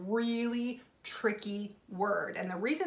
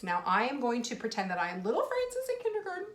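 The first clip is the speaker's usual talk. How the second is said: normal talking voice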